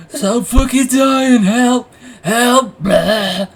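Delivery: very high, loud mock-dying cry; hammed-up panicked wail ending in a heave; imitated screaming and retching. Storyteller imitating friend on all fours, dry-heaving panicked screams. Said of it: "He's, like, dry heaving".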